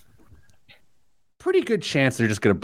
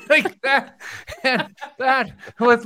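high-pitched, laughing through the words; 13 semitones higher, slower than usual. Real, not acted. Host ends his locksmith story, laughing. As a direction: crack up while wrapping up the anecdote.

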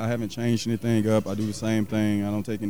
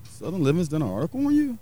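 higher, surprised question; higher-pitched mock surprise from the writer; imitating surprised writer; 7 semitones higher than usual. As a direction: raise the pitch in disbelief. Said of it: "he was like".

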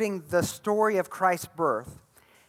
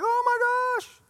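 much higher mock panic squeal; freaking out; slower than usual. Zechariah seeing the angel in the temple, mock panicked squeal. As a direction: play Zechariah, startled and panicked. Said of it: "Zechariah's freaking out".

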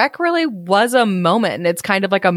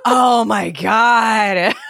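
slow, stretched-out disbelief; a long amazed oh my God; drawn-out oh my god; much slower than usual. Real, not acted. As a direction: drag out every word in shock. Reacting to Nicolas Cage as Shrek.

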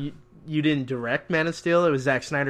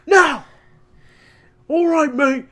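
much higher, louder mock British voice; mock British Bale; 13 semitones higher, 8 dB louder, slower than usual. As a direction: huff it like a sulky Brit. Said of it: "just like".

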